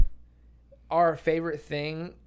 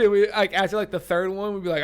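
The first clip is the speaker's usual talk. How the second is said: ordinary talk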